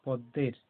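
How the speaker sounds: পদ্মের with a doubled দ and no ম sound; stressed দ, silent ম. Shown as a demonstration. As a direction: press the দ in পদ্মের, dropping the ম. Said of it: "দটাকে জোর দিয়ে বলা হয়".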